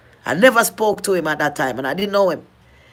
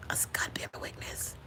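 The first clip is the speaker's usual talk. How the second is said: breathless whisper, barely there; real hushed vow; whispered oath; 21 dB quieter than usual. Real, not acted. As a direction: swear it under your breath. Solemn oath amid tearful story.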